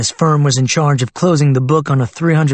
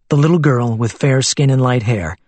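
plain reading voice

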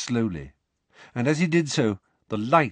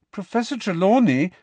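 higher, louder offended protest; hurt, indignant; 10 semitones higher, 5 dB louder than usual. A narrator's reading. Parvati protesting for Trelawney, hurt and indignant. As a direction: defend her teacher, stung. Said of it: "in a hurt and indignant voice".

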